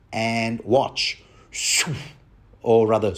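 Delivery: ordinary delivery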